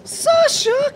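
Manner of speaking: very high, distant-sounding call; high-pitched searching cry of a name. Acted. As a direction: call out for a lost child. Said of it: "crying out".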